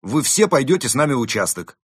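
loud, forceful police order; barked command. A narrator's reading. First moose arresting the wolves, barking orders. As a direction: bark out the arrest. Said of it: "рявкнул первый лось".

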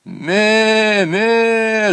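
high, loud "Ме, ме" bleats; goat bleat. A narrator's reading. Goat bleats again at the teasing, loud. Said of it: "снова закричал Полкан".

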